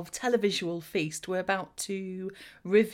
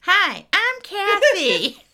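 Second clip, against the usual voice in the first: higher, louder mock American accent; American accent impression; 5 semitones higher, 6 dB louder than usual. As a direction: ham up a Kathy back from America. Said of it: "the American years?".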